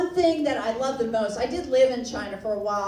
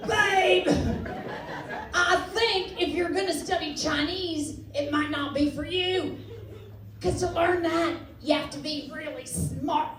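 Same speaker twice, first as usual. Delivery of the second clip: slightly raised cartoon cowboy voice; Yosemite Sam; 3 semitones higher than usual. Boyfriend doubting girlfriend can learn Chinese, mock cowboy. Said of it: "I like those Yosemite Sam guys".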